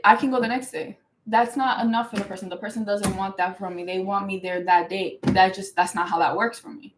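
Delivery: ordinary talk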